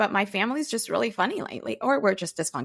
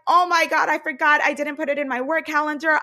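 panicked realization; 5 semitones higher, 4 dB louder than usual. Retold or imitated. Panicked mom realizes missed meeting.

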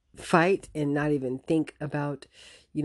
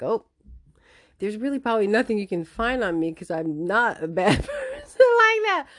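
fast, higher, laughing smug voice; mock self-assured; 6 semitones higher, 4 dB louder than usual. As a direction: play the innocent with a laugh. Imitating self-righteous self.

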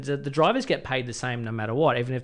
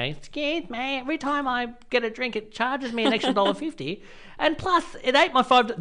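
higher-pitched, fussy complaining customer voice; male Karen complaint; 8 semitones higher than usual. Retold or imitated. Man imagines ringing the vending company as a Karen, fussy and higher. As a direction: play the entitled caller ringing the company. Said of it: "Do I be the male Karen and call up and go".

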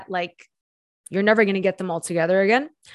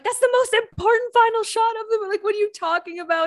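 much higher, incredulous retold reaction; mock outrage; faster than usual. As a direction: play your own disbelief back, pitched way up. Retelling own outraged reaction.

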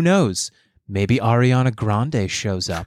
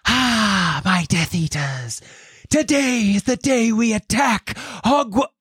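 high, breathy villain snarl; Voldemort voice; 10 semitones higher than usual. Retold or imitated. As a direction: play the dark lord, high and sinister. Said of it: "Voldemort would probably be like".

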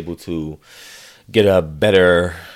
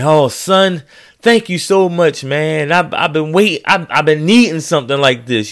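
higher, louder happy dad voice; grateful dad; 7 semitones higher, 3 dB louder than usual. Retold or imitated. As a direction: thank the son like a thrilled father. Dad thanking son gratefully.

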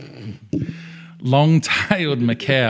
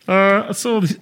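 pitched higher and louder, a hammed-up dopey bloke; mock dopey voice; 9 semitones higher, 4 dB louder than usual. Teasing a co-host by mimicking how he tells a story, hammed-up dopey voice. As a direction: lampoon a mate blurting out a story. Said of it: "not that you talk like that".

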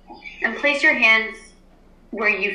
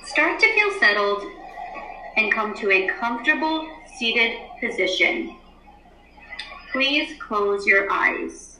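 guided meditation. Acted. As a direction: ease the class into stillness. Teacher leads class meditation.

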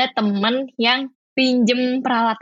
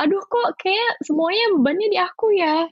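higher-pitched retold grumble; mild mock complaint; 8 semitones higher than usual. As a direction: lightly act out feeling overburdened. Retold inner complaint about burden.

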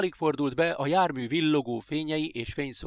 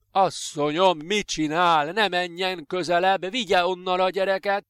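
loud, higher urgent shout; policeman shouting; 6 semitones higher, 5 dB louder than usual. Acted. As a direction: yell a warning at the woman from a distance. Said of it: "kiáltotta oda nekik a rendőr". (a rendőr magasabb hangon, hangosan kiabál az asszonynak)

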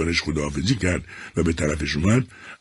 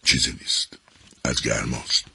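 lower, subdued husband's reply; husband hiding jealousy, lower and subdued; composed husband; 5 semitones lower than usual. A narrator's reading. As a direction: brush it off, lower and restrained. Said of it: "به خودش مسلط شد و گفت".

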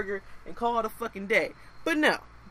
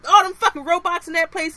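normal talking voice

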